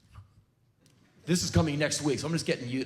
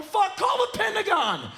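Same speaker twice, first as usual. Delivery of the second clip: much higher panicked cry; mock panic. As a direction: yell for the Pentagon in terror. Panicked neighbors quoted.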